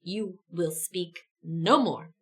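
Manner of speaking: slow, low, icy order to be silent; cold command. Acted. Teen silences Guy with an icy slow command. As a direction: freeze him out slowly. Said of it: "silences Guy with a piercing ice blue glare".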